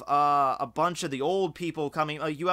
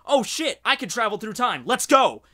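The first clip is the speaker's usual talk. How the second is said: higher and louder eager rush; mock excited Ashi; 6 semitones higher, 6 dB louder than usual. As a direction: snap straight into action. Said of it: "No, it's like".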